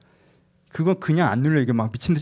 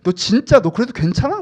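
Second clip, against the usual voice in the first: loud, high, agitated challenge; fear raising a ruckus; 9 semitones higher, 8 dB louder than usual. Acted. Fear confronts someone wanting security, loudly making a fuss. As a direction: pile up threats in a raised, high voice. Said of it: "막 이렇게 막 난리를 쳐요".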